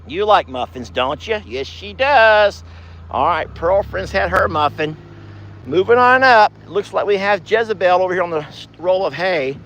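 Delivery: ordinary delivery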